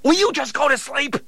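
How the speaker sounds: high, loud fed-up yell; exasperated yell. Acted. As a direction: snap at him to sleep. Steve fed up with Harry.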